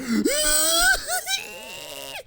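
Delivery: sharp high gasp into a laugh; startled gasp. Real, not acted. Gamer jolted by a jumpscare, sharp gasp. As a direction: gasp in real fright. Sudden scare during game.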